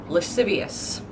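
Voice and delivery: Lascivious as luh-SIV-ee-us; crisp s sounds in Lascivious; correct pronunciation. Shown as a demonstration. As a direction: say Lascivious with s, never sh. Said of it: "In my head".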